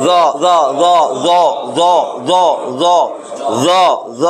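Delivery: repeated জ with tongue tip on upper teeth; Arabic jeem drill sound; Arabic letter drill. Shown as a demonstration. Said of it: "জিহ্বার আগা উপরের দাঁতের আগার মধ্যে লাগাইয়া".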